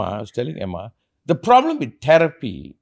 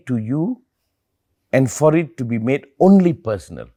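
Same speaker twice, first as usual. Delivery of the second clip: solemn teacher voice; slower than usual. Retold or imitated. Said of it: "So I tell the person".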